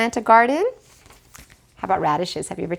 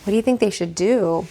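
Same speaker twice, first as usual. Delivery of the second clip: plain reading voice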